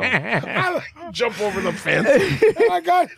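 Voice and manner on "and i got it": high, slow triumphant squeal; gleeful. Retold or imitated. Boasting to friends about grabbing the foil, gleeful and high. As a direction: savor the win up high. Snatching the tinfoil.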